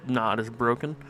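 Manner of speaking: ordinary delivery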